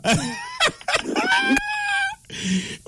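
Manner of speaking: helpless high-pitched laughter; high squealing laugh. Real, not acted. Host laughing at caller who stalled, high squealing laugh.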